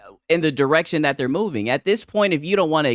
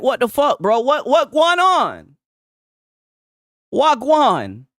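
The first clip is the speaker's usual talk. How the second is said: baffled; 7 semitones higher, 5 dB louder, slower than usual. Retold or imitated. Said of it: "I'm just like".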